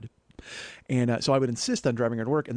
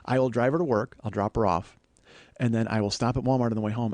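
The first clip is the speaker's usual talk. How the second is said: ordinary delivery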